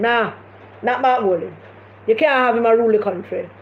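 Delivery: ordinary talk, nothing marked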